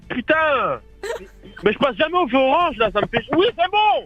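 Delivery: annoyed whining driver. Acted. Malik énervé au feu.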